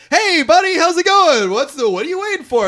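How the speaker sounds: high-pitched, breezy group hello; chirpy mock greeting. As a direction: imitate an oblivious, cheery greeting. Oblivious band greeting their manager.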